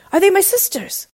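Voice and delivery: high, eager question; high-voiced hopeful husband. A narrator's reading. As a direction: ask urgently, pitched right up. Husband hopes rescue is arriving.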